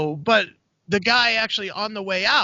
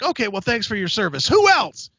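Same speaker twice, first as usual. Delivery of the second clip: brisk brush-off; 3 semitones higher, 3 dB louder than usual. Retold or imitated. Comedian moving on to the crowd, brisk dismissal. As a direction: thank them in passing and move straight on. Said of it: "wasn't just like".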